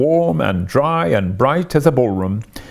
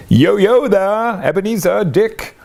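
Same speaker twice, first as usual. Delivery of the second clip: jovial Fezziwig; 9 semitones higher than usual. A narrator's reading. Old Fezziwig calling his apprentices, rich jovial voice. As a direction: boom out a hearty welcome to the apprentices. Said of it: "called out in a comfortable, oily, rich, fat, jovial voice".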